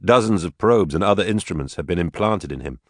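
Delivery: ordinary delivery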